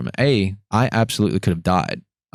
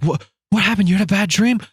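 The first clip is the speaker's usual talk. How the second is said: high-pitched mimicked girlfriend voice; high, anxious questioning; concerned girlfriend; 12 semitones higher than usual. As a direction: ask as a worried partner would. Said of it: "patting me on the back".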